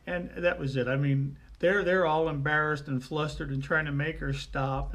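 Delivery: usual speaking voice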